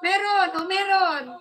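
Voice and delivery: loud, emphatic mic check; insistent. Real, not acted. Streamer proving to viewers the mic works, insistent.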